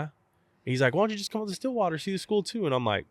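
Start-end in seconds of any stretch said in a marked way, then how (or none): 0.9-2.6 pushy coach. Retold or imitated. Coach pressing on the phone.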